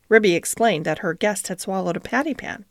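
ordinary delivery, nothing marked

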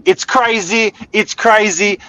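high, loud hype-man delivery; excited hype. Acted. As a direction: sell it like a promo teaser. Promo intro, repeated hook.

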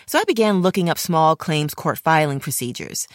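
normal talking voice

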